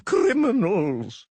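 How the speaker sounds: loud, very slow yells; drawn-out yells. Acted. Official yelling from the floor, drawing out each cry. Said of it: "yelled the skinny individual".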